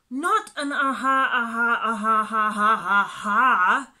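loud, exasperated repeating; insisting. A narrator's reading. Wasp insisting on its name to the laughing animals, loud. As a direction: hammer the name out crossly. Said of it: "insisted the wasp".

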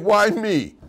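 loud, high-pitched mock wail; mock crying. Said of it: "starts crying and saying".

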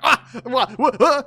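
high, loud stammering panic; mock shock. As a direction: sputter in exaggerated alarm. Acting out wife shocked by divorce.

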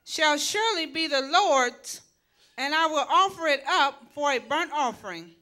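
normal talking voice

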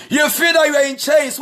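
usual speaking voice

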